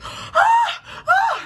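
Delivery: very high, loud screaming; retelling her scream of shock; reenacted scream. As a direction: scream it again like at the twist. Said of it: "And I started screaming".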